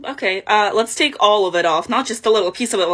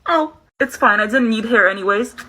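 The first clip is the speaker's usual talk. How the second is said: ordinary talk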